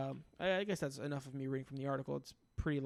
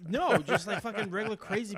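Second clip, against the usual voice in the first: laughing; 5 semitones higher, 6 dB louder than usual. Real, not acted. Laughing while explaining.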